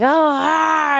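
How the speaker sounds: loud, high mock scream of rage; imitated angry scream. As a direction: imitate a friend blowing up. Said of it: "she'll, like, scream and get mad".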